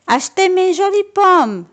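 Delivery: storybook hawker's shout; shouting at the top of his voice. A narrator's reading. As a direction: call out to passers-by, pitched high. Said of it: "crie Patapon à tue-tête".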